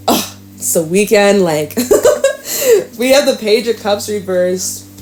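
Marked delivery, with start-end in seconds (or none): 1.8-2.9 high giggle. Real, not acted. Laughing at the weekend.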